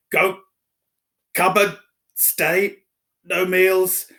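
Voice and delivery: high, loud, slow broken words; sputtering fury. A narrator's reading. Uncle Vernon too angry to speak to Harry, high and loud. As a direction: choke out each word in rage. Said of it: "so angry he could hardly speak".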